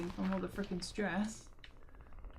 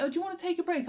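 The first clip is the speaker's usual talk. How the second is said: kind coworker; 8 semitones higher, much faster than usual. Retold or imitated. Voicing the kind assistant manager.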